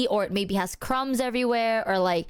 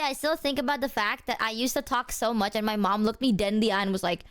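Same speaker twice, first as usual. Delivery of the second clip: usual speaking voice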